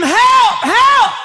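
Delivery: high, loud shout of help; retold shout for help, high and loud; yelling for help. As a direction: holler for help. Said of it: "I start yelling".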